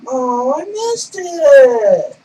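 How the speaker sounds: slow, high, loud lament; drawn-out disappointed groan. Real, not acted. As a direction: drag it out in dismay. Missed in game.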